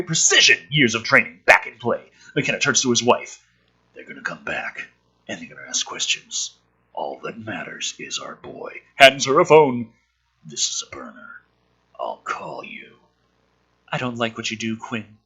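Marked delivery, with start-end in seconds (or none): 3.9-8.8 lower, softer serious voice; a grim quiet vow to his wife; low hushed husband. Acted. Husband quietly tells his wife to stay. Script dialogue read as McKenna. 10.4-13.0 hushed secret whisper. Acted. McKenna whispers to his wife handing a phone.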